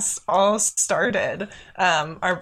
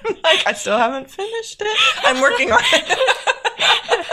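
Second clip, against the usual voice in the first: laughing; 5 dB louder than usual. Real, not acted. Admits unfinished book, laughing.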